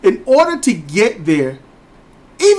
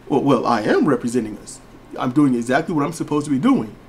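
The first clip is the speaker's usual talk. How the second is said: the formal voice of someone who left home; proper talking; 7 semitones lower, 5 dB quieter than usual. Retold or imitated. The son defending himself in a lower, softer proper voice. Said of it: "when did you start talking like this".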